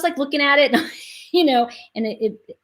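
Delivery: normal talking voice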